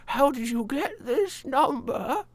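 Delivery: put-on high, slow, soft old woman voice; a frail elderly lady on the phone. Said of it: "It'd be a very old woman's voice".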